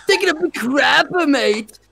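high, straining mock accent; high-pitched toilet-strain voice; constipated accent. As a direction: squeeze the words out as if constipated. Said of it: "Just sound constipated the whole time".

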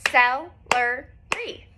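celery very slow, each syllable apart; cel-e-ry, slowly clapped. Shown as a demonstration. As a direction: stretch celery out, one clap per syllable. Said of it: "how many were in this one".

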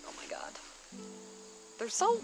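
ordinary talk

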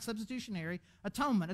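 "Substitutionary atonement" said quickly in one breath; rattled off. Shown as a demonstration. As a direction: toss off "Substitutionary atonement" fast and easy. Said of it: "but you make it longer".

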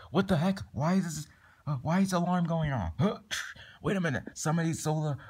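high-pitched, startled questioning; alarmed surprise. Acted. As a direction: react startled to an alarm going off. Acted alarm at a theft.

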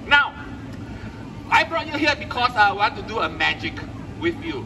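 normal talking voice

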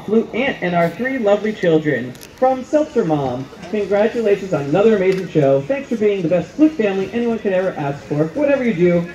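usual speaking voice

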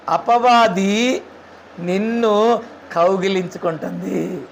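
drawn out very slowly, hushed at the end; slow dramatic warning. Shown as a demonstration. Preacher warning the congregation about the devil, slow and drawn out. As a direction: stretch each word out, let it sink in.

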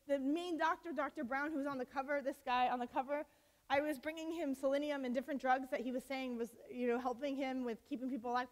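ordinary talk

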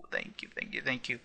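hushed relieved thanks; whispered thanks. Real, not acted. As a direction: breathe the thanks quietly. Grateful after lucky paralysis.

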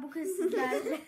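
trying not to laugh; stifled giggles. Real, not acted. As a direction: laugh creeping into the words. Breaks again.

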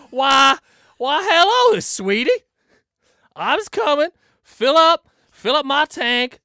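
high, loud American drawl at the gas station; American accent. Pretend. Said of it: "you're an American man that's walking into a gas station".

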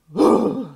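loud startled gasp; sharp gasp. Acted. Reader gasping at a spirit message, loud startled gasp.

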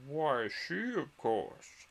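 lightly voiced matter-of-fact dodo; dodo character voice. A narrator's reading. Dodo pointing out Alice to the party, lightly in character. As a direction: answer in a hint of dodo voice. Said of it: "said the dodo".

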